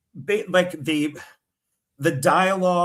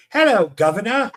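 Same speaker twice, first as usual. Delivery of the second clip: mock Cockney; 4 semitones higher, 6 dB louder than usual. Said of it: "get into the".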